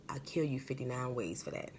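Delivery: hissed, half-joking threat; low whispered warning; menacing whisper. Acted. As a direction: whisper the threat close and cold. Threat over the injured child.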